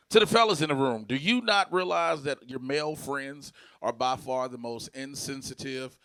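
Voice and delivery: usual speaking voice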